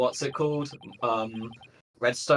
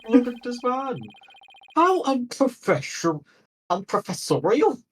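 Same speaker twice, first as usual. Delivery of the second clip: grandiose Viking professor, higher; 4 semitones higher than usual. Acted. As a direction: boom it out like a pompous Viking scholar. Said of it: "what Viking voice do I give him".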